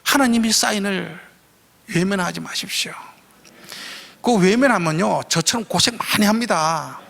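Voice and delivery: ordinary delivery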